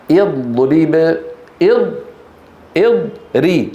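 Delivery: اضرب slowly, then "id", then "id-ri"; sounded out by syllable. Shown as a demonstration. Teacher isolating the kasra on the third letter, syllable by syllable. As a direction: break اضرب apart, starting on i. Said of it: "الحرف الثالث الراء مكسور".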